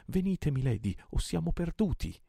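high, alarmed urging; urgent plea. A narrator's reading. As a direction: hurry her away in alarm. Urging her out of burning ship. (esortazione acuta e allarmata)

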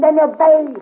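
loud, clipped order; sharp command. Acted. Officer ordering the defiant cavalryman to obey. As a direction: bark it as an order.

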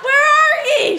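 high, loud Newfoundland mother's yell. Retold or imitated. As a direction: yell into the smoky shed in a Newfoundland accent. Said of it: "she yelled again".

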